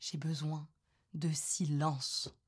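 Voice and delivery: soft, low and very slow; hushed, drawn-out appeal; quiet plea. Acted. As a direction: spell out the need slowly and softly. Pleads for space to think.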